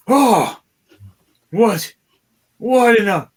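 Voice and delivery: high, loud startled yelps; startled shout. Acted. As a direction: jump back in shock and shout. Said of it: "jumps out of the way going".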